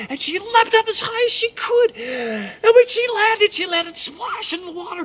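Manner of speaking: very high, loud burst; excited climax. A narrator's reading. Storyteller as the mouse leaps and splashes, excited climax. Narrator acts out leap and splash.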